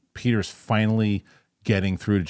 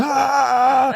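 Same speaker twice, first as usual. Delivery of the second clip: loud, very high mock scream; imitated scream; 7 dB louder than usual. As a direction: let out a full-voiced imitation battle scream. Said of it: "He's just screaming".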